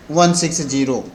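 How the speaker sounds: ordinary talk, nothing marked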